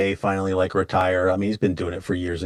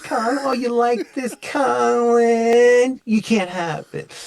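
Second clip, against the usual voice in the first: high teasing New York voice; sing-song Brooklyn taunt; New York accent; 5 dB louder, slower than usual. Retold or imitated. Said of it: "She's talking in a New York accent".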